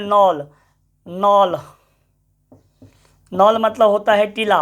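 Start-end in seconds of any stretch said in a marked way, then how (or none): 0.1-1.6 नॉल said as "nol", the k left silent; teacher demonstrating नॉल as "nol". Shown as a demonstration. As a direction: say नॉल with no k sound, just "nol". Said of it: "के N O double L".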